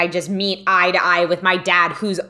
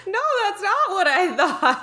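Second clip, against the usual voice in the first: laughing; 11 semitones higher than usual. Real, not acted. Laughing at friend's story.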